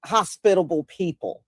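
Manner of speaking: ordinary delivery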